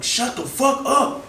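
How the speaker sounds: high, drawn-out, exasperated command; retold high-pitched snap; irritated outburst. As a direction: pitch it way up, slow and fed up. Snapping back at the yelling friend.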